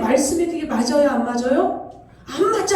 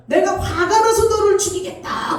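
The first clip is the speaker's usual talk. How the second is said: raised, furious threatening shout; angry shout; 5 semitones higher than usual. Retold or imitated. God's voice raging at the disobedient servant, shouting angrily.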